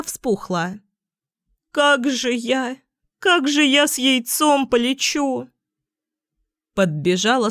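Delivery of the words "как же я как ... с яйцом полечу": mildly tearful goose. A narrator's reading. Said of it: "расплакалась".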